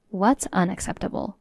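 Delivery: usual speaking voice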